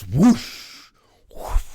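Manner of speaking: a loud, breathy whoosh for the divine hand; whoosh sound. Retold or imitated. Testimony to friends, miming God's hand sweeping down: loud whoosh. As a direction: sweep the sound out like a rushing wind.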